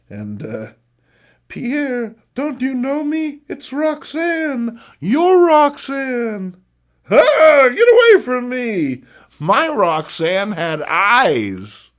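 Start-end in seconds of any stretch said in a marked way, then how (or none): 1.5-6.6 high, loud melodramatic comic-book woman; hammed-up pleading Roxanne. Acts out comic book dialogue. 7.1-11.9 very loud, high melodramatic cry; hammed-up horror. Acts out horrified comic reply.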